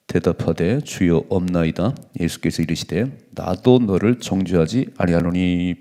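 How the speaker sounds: loud group Bible reading; scripture reading. Acted. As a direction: read the verse out together. Congregation reads scripture aloud. (성경 봉독)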